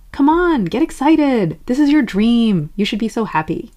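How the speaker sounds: high, loud push, easing at the end; forceful pep talk. Acted. Pushing yourself to love your dream, forceful cheer. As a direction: urge hard, punching each phrase. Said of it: "Being forceful would be".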